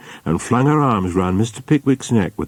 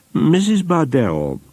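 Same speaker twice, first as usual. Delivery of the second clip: hesitant Pickwick; 9 semitones higher, slower than usual. A narrator's reading. Pickwick nervously opening a proposal.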